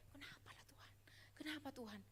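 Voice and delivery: breathy whisper to God, barely voiced; whispered plea. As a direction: ask God why under your breath. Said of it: "Cuman kayak".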